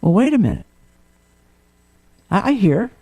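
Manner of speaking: usual speaking voice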